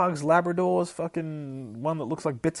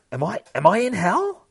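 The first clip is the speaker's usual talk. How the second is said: high, loud, stammered question climbing at the end; alarmed inner monologue; 8 semitones higher, 6 dB louder than usual. Acted. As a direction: panic upward into the question. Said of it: "we get the inner monologue".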